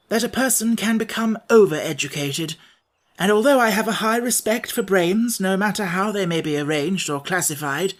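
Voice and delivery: a lightly voiced, kindly but firm Scarecrow; gentle Scarecrow. Scarecrow to Wogglebug, polite but firm.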